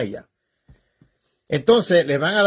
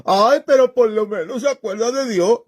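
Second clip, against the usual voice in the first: higher, whiny imitation of a complaining churchgoer; mock whining; 6 semitones higher than usual. Preacher mocking whining fans of reggaeton, in a high whiny voice. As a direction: whimper it out mockingly like a crybaby believer. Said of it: "dicen lloriqueando".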